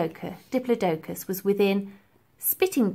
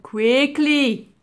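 put-on urgent, louder commanding old man; stern professor; 5 semitones higher, 7 dB louder than usual. A narrator's reading. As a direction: snap it out as an order. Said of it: "ordered the professor".

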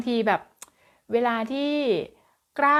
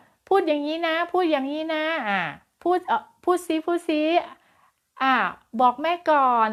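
pushy parent prompting; 7 semitones higher than usual. Retold or imitated. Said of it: "จากกระตุ้นว่า".

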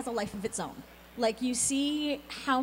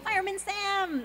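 excited; 10 semitones higher, slower than usual. Real, not acted. Excited recognition of favorite show.

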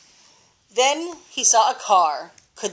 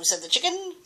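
usual speaking voice

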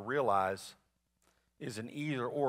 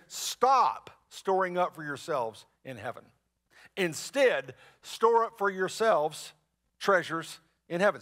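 slow, louder stop on "Stop" and "store"; commanding emphasis; 4 dB louder, slower than usual. Shown as a demonstration. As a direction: hammer the command home. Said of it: "He says".

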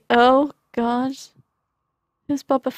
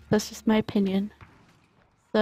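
usual speaking voice

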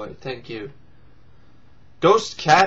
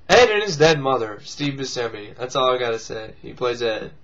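ordinary talk, nothing marked

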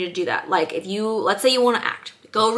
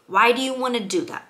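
ordinary talk, nothing marked

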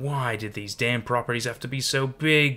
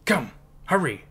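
put-on higher, urgent call in a Dutch-tinged accent; urgent command; 5 semitones higher, 4 dB louder than usual. A narrator's reading. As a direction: hurry them along sharply. Old woman urging escape from monsters.

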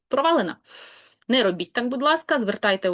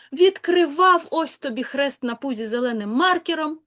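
insistent protest; 5 semitones higher than usual. Retold or imitated. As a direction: swear you did open your mouth, "Відкривав!". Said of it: "клянеться людина тобі, божиться".

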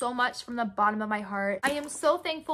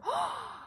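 very high rising gasp; excited gasp; 5 dB quieter than usual. Real, not acted. Vlogger reading a fan's letter, an excited gasp. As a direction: gasp as the surprise hits. Reads about a gift.

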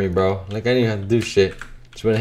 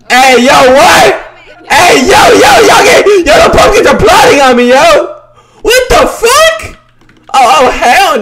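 very high, loud frantic yelling; panicked screaming; 10 dB louder than usual. Real, not acted. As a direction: scream in shock at the attack. Panic as pumpkins attack.

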